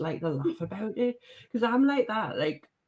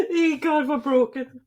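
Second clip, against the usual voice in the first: high, breathless laughter through the words; laughing helplessly; 10 semitones higher, 5 dB louder than usual. Real, not acted. Host dissolving into laughter at the guest's story, laughing hard. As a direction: crack up and can barely get words out.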